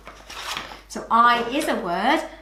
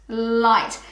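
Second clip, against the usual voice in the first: ordinary delivery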